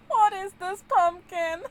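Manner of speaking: high, loud delighted question; exclaiming at a silly pumpkin; surprised and delighted; amused surprise. Real, not acted.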